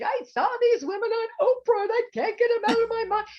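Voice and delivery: high, loud, tearful-sounding plea to husband; high wailing plea. Retold or imitated. Telling her husband through tears, high and loud. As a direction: pitch it up and wail it out.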